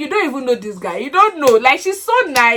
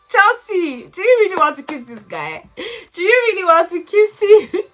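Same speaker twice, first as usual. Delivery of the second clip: higher, urgent call; 8 semitones higher than usual. Real, not acted. Viewer calling out to Chelsea on TV, higher and urgent. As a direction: warn her before the kiss.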